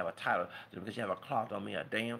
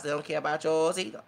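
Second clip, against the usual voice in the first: ordinary delivery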